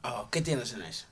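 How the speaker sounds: ordinary delivery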